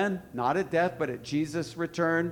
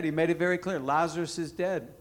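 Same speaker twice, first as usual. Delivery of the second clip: ordinary talk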